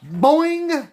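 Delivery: a high springy boing, way up; cartoon boing. Price bounces off line.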